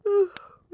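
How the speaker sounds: high, loud, falling sigh; voiced sigh winding down for the night; sleepy sigh. Queen winding down with her partner, sleepy sigh.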